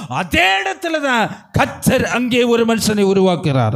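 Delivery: high, urgent sermon delivery; impassioned pulpit exhortation; fervent preaching. Real, not acted. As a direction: preach with rising fervour, pressing each phrase home. Preaches at raised intensity.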